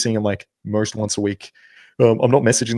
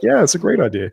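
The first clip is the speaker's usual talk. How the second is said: higher, overeager sarcastic yes; mock eager yes; 9 semitones higher than usual. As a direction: play the guy caving in, hammed-up. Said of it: "it's like".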